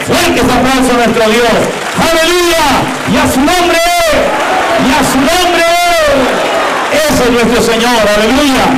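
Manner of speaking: full-force celebratory preaching; rousing praise. Real, not acted. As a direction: whip up the congregation. Preacher calls for applause.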